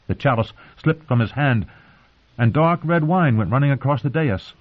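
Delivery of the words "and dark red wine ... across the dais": plain reading voice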